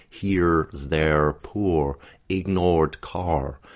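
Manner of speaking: very slow, R-coloured "Here's their poor ignored car"; held R tension. Shown as a demonstration. Coach showing tension never let go, strong R in "ignored car". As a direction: keep the tongue curled right through "poor ignored car". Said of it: "don't let go of that tension ever in between".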